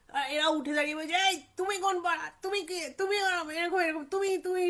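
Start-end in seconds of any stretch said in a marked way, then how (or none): 1.3-4.6 heated quarrel. Retold or imitated. Boys arguing back at stranger.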